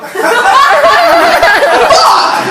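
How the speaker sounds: high burst of real laughter; real loud cackle. Real, not acted. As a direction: crack up loudly. Big laugh at joke.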